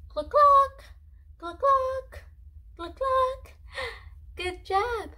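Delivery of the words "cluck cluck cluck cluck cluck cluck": high loud clucking; chicken cluck imitation. Shown as a demonstration. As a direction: cluck like a hen. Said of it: "Let's do it together".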